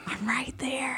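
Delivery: playful stage whisper; hushed, sneaky aside; secretive whisper. Pretend. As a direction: whisper it like a gleeful lurker. Pretending to lurk near old workplace.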